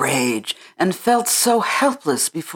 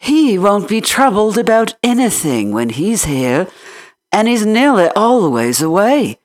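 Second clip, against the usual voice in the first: higher, lively country housemaid voice; chatty Yorkshire maid; 4 semitones higher than usual. Acted. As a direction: rattle on like a talkative maid. Martha the Yorkshire maid talks.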